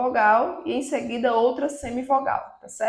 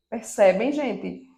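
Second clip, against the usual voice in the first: normal talking voice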